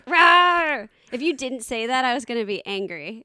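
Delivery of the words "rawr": loud roar. Pretend. Co-host echoes the yeti roar.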